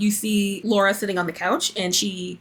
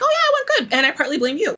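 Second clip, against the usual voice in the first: high, put-on sarcastic cheer dropping off; 5 semitones higher, faster than usual. Host voicing the woman answering her friend, sarcastic. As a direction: imitate someone sarcastically saying it went fine. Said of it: "in a sarcastic way".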